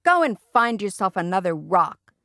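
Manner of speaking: ordinary delivery, nothing marked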